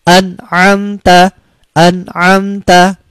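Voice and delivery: very slow an'amta, n distinct before the ain; clear idhar halqi. Shown as a demonstration. Teacher modelling the throat izhar slowly. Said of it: "bacaan idhar halqi".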